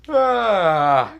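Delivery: big, loud mock yawn. Asta interrupting the villain's speech, exaggerated yawn. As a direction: yawn noisily over someone's speech. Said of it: "he, like, yawns".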